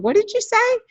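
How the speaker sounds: high, startled mom asking in disbelief; shocked question. Retold or imitated. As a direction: gasp out the question, stunned her daughter swore. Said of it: "And I was so shocked".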